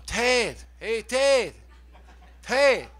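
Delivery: calling across a bar; mock calling out. Fan calling to singer from behind in bar, mock calling out. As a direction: hail him from behind. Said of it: "this voice behind me going".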